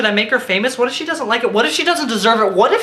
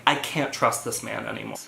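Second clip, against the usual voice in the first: deadpan quiet aside; 11 semitones lower, 10 dB quieter than usual. Retold or imitated. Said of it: "I was like".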